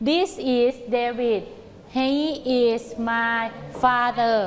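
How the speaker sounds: very slow, word-by-word; slow choral drill. Shown as a demonstration. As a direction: chant it slowly together. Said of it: "พร้อมๆกันใหม่".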